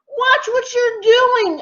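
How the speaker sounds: high-pitched protest at the monkeys; complaining. Acted. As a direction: complain sharply, voice way up. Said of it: "I complained".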